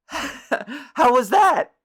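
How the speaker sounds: a prankster coyly asking after his fart. Retold or imitated. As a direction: ask it teasingly, drawn out. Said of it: "coyly, he, he goes".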